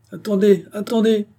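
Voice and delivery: high loud call over the applause. A narrator's reading. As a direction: stop the crowd sharply. Orator halts applause, hand raised.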